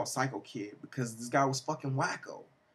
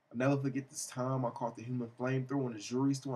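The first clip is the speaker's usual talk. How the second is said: usual speaking voice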